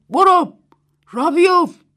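very loud, high urgent command; commanding shout. A narrator's reading. Old Mrs Wang striking the girl to make her flee, very loud shout. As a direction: drive her away with a yell.

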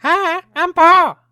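high, slow hooting monkey voice. Pretend. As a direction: squeal it out like a goofy monkey. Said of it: "we all have to do the monkey voice".

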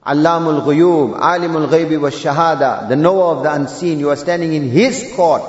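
fervent sermon climax, rising to a cry; preaching at full force. Acted. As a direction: thunder it at the congregation like a rousing imam.

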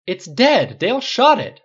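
high, loud, indignant cry; loud protest. A narrator's reading. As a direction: protest at the top of your voice. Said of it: "Riley protested".

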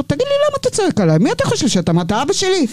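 high, fast indignant comeback, pitch jumping way up; retold angry retort. Snapping back at the police commander who yelled at her, high and fast. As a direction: fire it back at him fast and outraged. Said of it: "ואז אני אומרת לו".

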